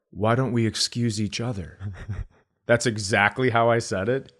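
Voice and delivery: normal talking voice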